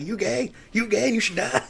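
high, hammed-up taunting jibe; mock taunt. MPs taunting the Prime Minister, high mock taunt. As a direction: needle him like a rowdy MP.

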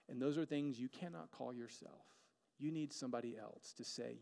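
normal talking voice

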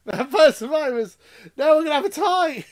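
high, loud delighted exclamation; real excited outburst. Real, not acted. Delighted that both picks match.

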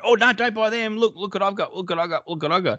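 mock a pushy online ad luring you in. Mocking pushy online ads.

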